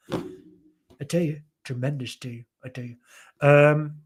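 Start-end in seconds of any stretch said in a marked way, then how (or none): 0.1-3.0 slow, boastful American politician; drawn out, then trailing off softer; Donald Trump. Acted. Streamer resuming politician's praise of the team, Trump impression. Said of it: "was that a Donald Trump".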